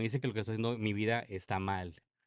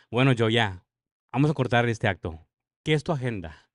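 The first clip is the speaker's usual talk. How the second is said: louder, impatient cut-off; retold brusque demand; 11 dB louder than usual. Church man cuts short a tearful confession, brusque. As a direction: imitate someone done listening, pushing for answers.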